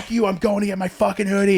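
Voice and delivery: mock angry comeback; retold yelling back. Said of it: "I'm yelling back at Dad".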